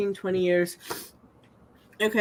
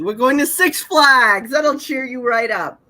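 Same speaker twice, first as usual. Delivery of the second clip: upbeat, louder, a little slower; lightly voiced cheerful Lovett; 4 semitones higher, 6 dB louder than usual. Reading Lovett cheering up the girl, lightly voiced and upbeat. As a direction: sell the treat brightly. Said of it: "how come she gets to say".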